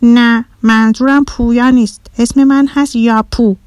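put-on mildly cross little boy; frowning. A narrator's reading. Boy correcting his mistaken name, mildly frowning. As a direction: correct them firmly, with a slight pout. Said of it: "پسر کوچولو اخم کرد و گفت".

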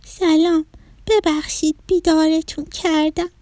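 high, shy little-broccoli voice; embarrassed childlike apology; bashful. Acted. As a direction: apologise sheepishly in a small high voice. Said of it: "بروکلی با خجالت گفت".

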